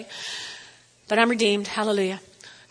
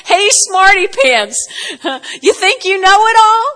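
higher and louder teasing with a laugh inside; God mocking Job, higher and louder, laughing; sarcastic God again; 7 semitones higher, 5 dB louder than usual. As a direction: jab him and crack up. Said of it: "Did you hear that?".

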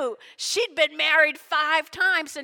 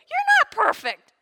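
pointed high jab; 13 semitones higher, 6 dB louder than usual. Real, not acted. Pointing at congregation.

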